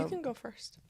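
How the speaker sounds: whispered aside. Real, not acted. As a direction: whisper it to her. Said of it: "whispered aside".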